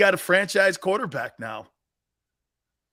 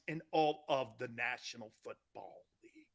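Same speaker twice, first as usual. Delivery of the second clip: whisper; 6 semitones lower, 14 dB quieter than usual. Acted. Host teasing the list to viewers, whispered. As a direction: drop to a secret whisper. Hushed end of countdown intro.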